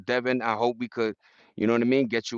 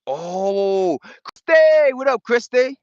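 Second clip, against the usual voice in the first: high, loud shout-out to a fan; excited greeting; 10 semitones higher, 7 dB louder, slower than usual. Real, not acted. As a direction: call her name out big and happy. Greets viewer Christy excitedly.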